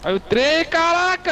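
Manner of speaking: high, loud startled yell; shocked cry. Real, not acted. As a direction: yell as the train hits. Train hits them.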